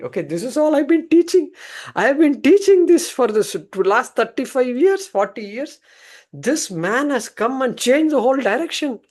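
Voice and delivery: a scholar whose whole teaching just collapsed; high excited protest; flustered outburst. Acted. Guru's flustered inner outburst.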